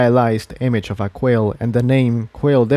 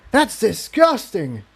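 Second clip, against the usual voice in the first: higher, slow, revolted; disgusted gag; 9 semitones higher, slower than usual. A narrator's reading. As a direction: spit the words out in disgust. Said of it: "he gagged".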